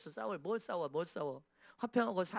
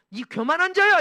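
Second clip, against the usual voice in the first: booming rebuke, much louder and higher; furious divine shout; God's rebuke; 9 semitones higher, 14 dB louder than usual. Retold or imitated. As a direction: thunder it like God scolding the proud. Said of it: "이렇게 하시잖아".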